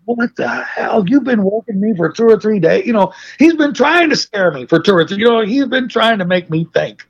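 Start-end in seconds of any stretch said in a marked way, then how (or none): 0.1-2.9 high-pitched retold protest; indignant disbelief. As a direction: replay your baffled complaint, voice pitched up. His own retold protest at prank.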